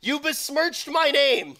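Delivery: high, drawn-out theatrical protest; mock outrage. Co-caster accuses Richard's partner in toilet quarrel, mock outrage. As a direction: cry out in hammed-up wounded honor.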